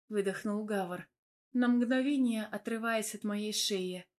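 ordinary delivery, nothing marked

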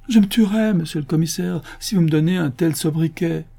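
high, anguished supplication; desperate pleading. A narrator's reading. Absalom begging the commissioner to spare him the nickname, pleading. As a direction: beg as if your life depends on it. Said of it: "supplia Absalom".

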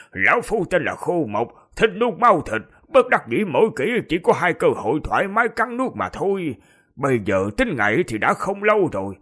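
lightly voiced creepy corpse; menacing, hungry old ghoul; sinister corpse voice. Dry corpse Kho Moc speaks.

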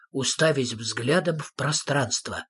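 plain reading voice

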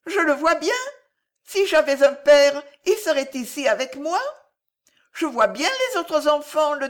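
put-on high, loud child making his case; aggrieved little boy. A narrator's reading. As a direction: complain like a small orphaned boy. Child explains he has no parents. (voix aiguë de petit garçon peiné)

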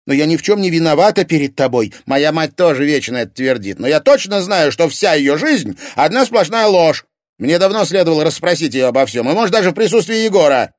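high, loud, fast agitated tirade; heated outburst. Acted. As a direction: work himself up to confront her. Heated quarrel, storming off.

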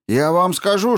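high, loud furious outburst; angry shouting. A narrator's reading. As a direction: yell it in his face. Eddy shouting in a quarrel.

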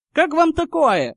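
high, loud announcement. A narrator's reading. Harry proposing terms to the crowd, loud. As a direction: project it to the whole crowd. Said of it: "громко начал Гарри".

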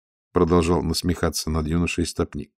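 ordinary delivery, nothing marked

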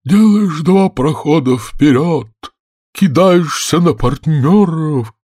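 high, slow, loud intoning; plaintive creature getting carried away; animated storybook turtle. A narrator's reading. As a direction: stretch out each dance step. Mock Turtle explains dance, animated.

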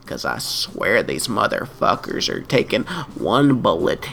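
low, menacing hitman voice; sinister low gunman. Pretend. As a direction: growl it like a killer. Sinister gunman playing the lyric.

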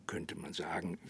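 a dry aside, dropped to a whisper. Acted. Sheriff adds a knowing aside to the woman, whispered. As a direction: let it slip out under your breath.

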